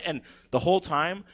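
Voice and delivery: ordinary talk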